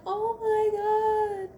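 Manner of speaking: high, slow, drawn-out mock sob; hammed-up teary gush; mock tearful. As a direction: melt like you're about to cry. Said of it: "I cannot watch that video without crying".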